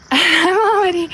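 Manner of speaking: panting from the stairs; breathless, gasping between words; out of breath. Real, not acted. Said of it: "out of breath".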